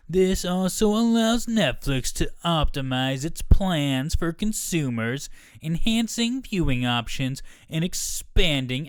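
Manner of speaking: slow, high, booming corporate voice; new company voice. Retold or imitated. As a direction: read it like a pompous company spokesman. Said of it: "I'll do a new voice for the company".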